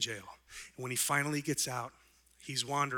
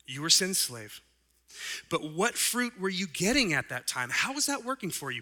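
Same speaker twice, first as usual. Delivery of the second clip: fired-up preaching; 4 semitones higher, 4 dB louder than usual. Acted. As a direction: preach Paul's words with fire. Preaching Paul's verses full force.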